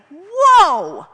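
real awe at being lifted; amazed whoa. Real, not acted. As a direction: let the wonder burst out. Amazed at own uplift.

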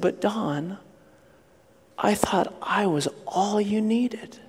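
soft, slow, tender reproach; gentle Jesus. Acted. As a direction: answer a crying child gently and quietly. Jesus gently answers crying child.

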